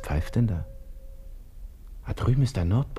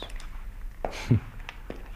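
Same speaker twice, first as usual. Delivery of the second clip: soft breathy chuckle; 8 dB quieter than usual. Acted. Laughing after greeting the watchman.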